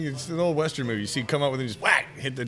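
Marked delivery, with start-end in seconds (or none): none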